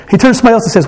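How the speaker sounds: normal talking voice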